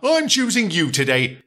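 cheery driver. Acted. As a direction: greet the little engine like a jolly driver. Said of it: "said the driver".

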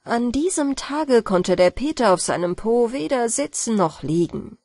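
ordinary delivery

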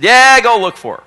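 high, loud dismissive husband; husband brushing off. Retold or imitated. Husband sending pastor off to find his wife, loud and dismissive. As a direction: wave him off, half laughing. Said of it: "And he said".

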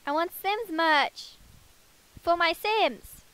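loud, high-pitched exasperated rant; angry. Real, not acted. Gamer who can't get to the Sims merch, angry and loud. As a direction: complain loudly in indignant disbelief. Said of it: "I'm really angry".

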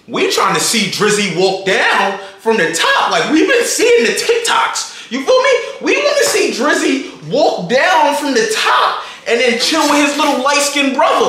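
worked-up, high-pitched urging; impatient urging. Retold or imitated. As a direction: hurry a friend along, voice climbing. Acting out hurrying Queen along.

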